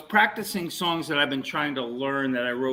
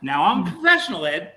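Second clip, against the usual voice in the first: ordinary talk, nothing marked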